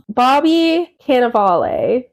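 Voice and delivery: slow try at Cannavale, ending in a sounded "ah-lay"; tentative pronunciation. Shown as a demonstration. Guessing at an actor's surname, Cannavale tried as "can-a-VAH-lay". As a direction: say Cannavale in four syllables, last one "lay". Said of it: "however his name is pronounced".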